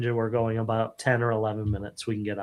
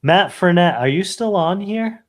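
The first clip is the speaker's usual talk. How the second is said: calling out; 5 semitones higher, 5 dB louder than usual. Real, not acted. Calling out to online viewer.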